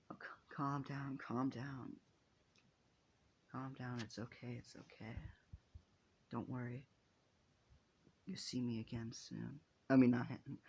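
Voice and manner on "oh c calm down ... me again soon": soft, hushed reassurance with pauses; gently calm a crying friend; soothing. Acted. Friend comforting the crying hero, soft and soothing.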